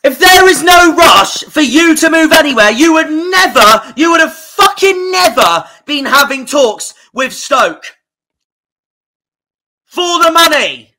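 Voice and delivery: real furious yelling at the player's quote; furious shout. Real, not acted. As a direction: rage at the player, spitting every word.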